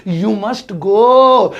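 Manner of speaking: very slow, loud, long GO; slow, emphatic dictation; drawn-out model sentence. Shown as a demonstration. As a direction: stretch each word out for the class. Teacher reads out English example answer.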